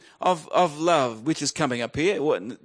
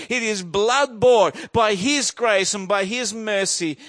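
fervent declaration, raised and driving; impassioned preaching; 4 semitones higher, 4 dB louder than usual. Real, not acted. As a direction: proclaim surrender with full conviction. Preaches at full force.

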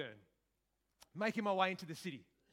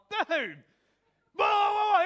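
loud high shout across the train; shouting a friend's nickname across a train carriage; calling out; 12 semitones higher, 12 dB louder, slower than usual. Retold or imitated. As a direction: yell out to a mate.

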